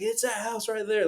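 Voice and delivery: high-pitched, insistent drunk man imitation; mock belligerent. Drunk man insists to the friends about the house, mock belligerent. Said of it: "getting belligerent with us".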